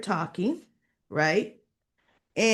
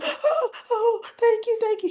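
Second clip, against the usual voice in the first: high-pitched, gushing mock gratitude; breathless-style "thank you" pitched way up; overjoyed thanks; 14 semitones higher than usual. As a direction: imitate someone squealing with relief. Acting out grateful relief at good news.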